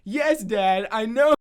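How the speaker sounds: loud, high, mock-dutiful retort; a sassy ten-year-old's answer; sarcastic kid reply. Boy answers dad's grim warning with loud high sarcasm.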